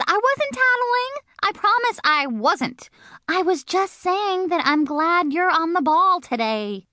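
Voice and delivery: put-on high, frantic begging little girl voice; pleading May. May pleading with the teacher, high desperate begging. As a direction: beg him high and fast not to send the note. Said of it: "May's whole mouth came open".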